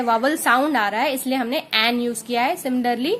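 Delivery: ordinary talk, nothing marked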